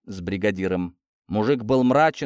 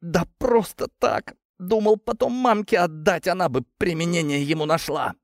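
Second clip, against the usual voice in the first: high, strained voice through pain; pained answer; 7 semitones higher than usual. A narrator's reading. Tortured worker explains why he took turpentine, in pain. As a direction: blurt it out while hurting.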